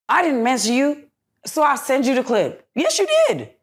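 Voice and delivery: real frustration, pitch climbing; rising outrage, near-shout at the end; agitated, high. Real, not acted. Telling the hosts about the fight with her ex, high and agitated.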